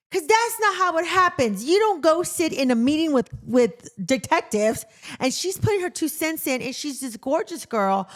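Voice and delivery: fired up, can't believe what TV shows; exasperated rant. Retold or imitated. Venting to her husband about an unrealistic cop show, loud and exasperated.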